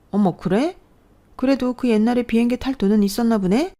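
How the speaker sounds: high, mock-surprised jab; cruel sneer. Sister-in-law mocking the old plane crash, sneering. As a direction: feign surprise, then twist the knife.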